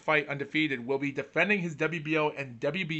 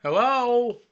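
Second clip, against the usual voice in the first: loud, high call of hello; 7 semitones higher, 6 dB louder, slower than usual. Real, not acted. Frozen camera, calling out to listeners, loud. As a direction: call out to check if anyone hears.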